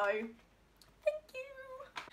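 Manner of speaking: slow high gushing thanks to a friend; sing-song gushing thanks. Real, not acted. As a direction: draw it out high and sweet.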